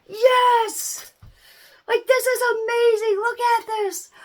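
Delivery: high, loud cheering; high excited shout of joy; excited parent. Retold or imitated. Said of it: "you're just like".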